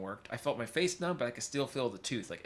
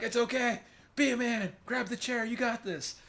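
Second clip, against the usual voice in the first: higher, pushy pep talk voice; coaching dentist; 10 semitones higher than usual. Retold or imitated. Dentist urging the patient to hold on, pushy. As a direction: gee him up to grab the chair.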